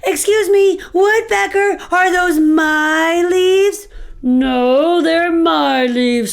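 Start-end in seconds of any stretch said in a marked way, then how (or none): 0.0-3.9 squirrel confronting a thief; agitated squirrel. Acted.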